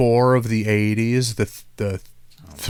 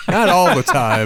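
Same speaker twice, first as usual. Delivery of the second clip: laughing; 5 semitones higher, 4 dB louder than usual. Real, not acted. Joking aside with laughter.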